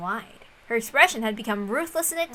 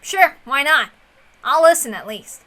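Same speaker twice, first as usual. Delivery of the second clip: ordinary delivery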